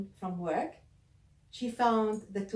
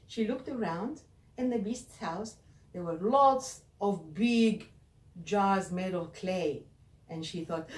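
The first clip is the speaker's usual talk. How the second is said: plain reading voice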